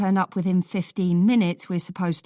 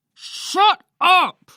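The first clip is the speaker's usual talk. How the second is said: much higher, loud furious yell; bellow; 13 semitones higher, 9 dB louder than usual. A narrator's reading. Older brother bellowing at Paulie to stop, furious. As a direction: roar at him to shut up. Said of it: "Lloyd bellows".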